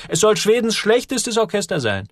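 hohe, drängende Jungenstimme; aufgeregt dagegenhalten. A narrator's reading. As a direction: platz mit dem Einwand hoch heraus. Joel redet das Orchester schlecht. (aufgeregter Junge, deutlich höher)

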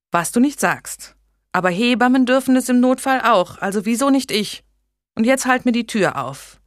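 put-on a resolute young woman insisting; Blanche, determined. A narrator's reading.

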